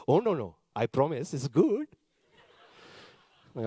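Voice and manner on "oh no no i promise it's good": put-on reassuring Indian-accented dad; dad's Indian accent. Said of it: "I'll do it in the accent".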